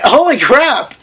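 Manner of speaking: sudden loud mock shock; holy crap, blurt it out. Real, not acted. Reading the fanfic aloud, blurting in mock shock.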